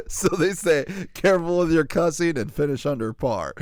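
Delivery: high, loud, tickled delivery; laughing while talking. Real, not acted. Host repeating the joke to co-host, laughing. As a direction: push the words out amid real laughter.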